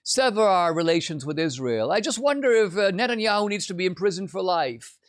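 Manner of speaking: high, coy innocent voice; just-asking-questions mock. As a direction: feign innocence while insinuating. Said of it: "Tucker wants to be like".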